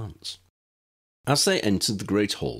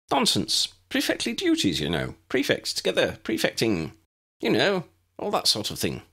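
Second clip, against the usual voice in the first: higher, eager prefect voice; higher pompous boy voice; 6 semitones higher than usual. A narrator's reading. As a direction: play the pompous prefect, pitched up. Eric voiced by narrator.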